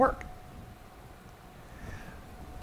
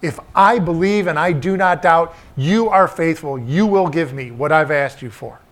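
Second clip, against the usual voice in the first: rising, emphatic preacher's cadence; preaching; 4 dB louder than usual. Acted. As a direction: preach it to the congregation with conviction. Preaching to the congregation.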